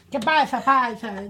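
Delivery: loud playful Mexican Spanish greeting. Pretend. Radio host puts on his Mexican Spanish voice for a greeting. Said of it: "put my Mexican voice back".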